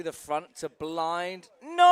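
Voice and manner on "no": high, loud cry; dismayed shout. Real, not acted. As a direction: yell in dismay. Rider falls.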